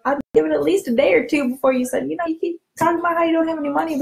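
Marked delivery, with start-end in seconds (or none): 2.1-4.0 pushy jab. Acted. Pushy jab at a broke friend.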